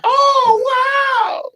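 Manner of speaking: very high, loud imitated crowd wow; hammed-up awestruck shout; mock amazement. Imitating everybody reacting to costume blowing up.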